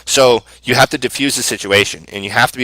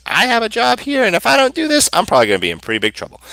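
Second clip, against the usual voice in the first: pleading; 11 semitones higher than usual. Retold or imitated. Pleading excuse to client.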